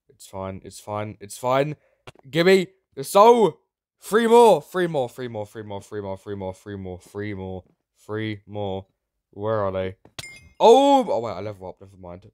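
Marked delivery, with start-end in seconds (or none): 2.3-5.1 high, loud slow demand; shrieking demand; greedy shout. Real, not acted. Demanding the soul. 10.6-11.3 high, loud oh wait; jolted shout; yelped surprise; startled. Real, not acted. Sudden in-game event.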